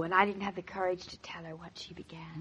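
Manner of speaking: hushed so Gertrude can't hear; whisper. Acted. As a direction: whisper it out of earshot. Quietly confiding about Gertrude.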